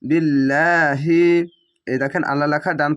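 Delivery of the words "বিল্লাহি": বিল্লাহি slow with thin light লা; slow বিল্লাহি with thin lam; light lam recitation. Shown as a demonstration. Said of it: "ল না বলে লা বলতে হবে".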